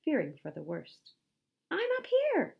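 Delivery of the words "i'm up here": very high, loud cheerful call; bright distant-style yell; shouting back. A narrator's reading. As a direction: holler from far above. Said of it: "Dwayne shouted back".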